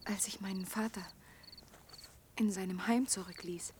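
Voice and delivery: soft whispered memory; hushed confession. Acted. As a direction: recall it barely aloud. She tells of her lonely flight. (leise, geflüsterte Erinnerung)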